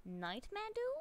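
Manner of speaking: squeaked puzzled question; calling out the viewer's name high and bright; high squeaky question. Real, not acted. Puzzled reading of a username.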